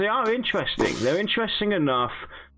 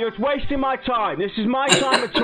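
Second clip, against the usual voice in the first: loud; 10 semitones higher, 6 dB louder than usual. Real, not acted. Host shouting down interrupting cohost.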